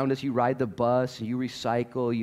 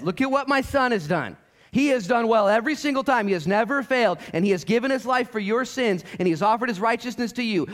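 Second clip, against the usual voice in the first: proclaiming; 11 semitones higher, 4 dB louder than usual. Acted. God's words, preached at full force.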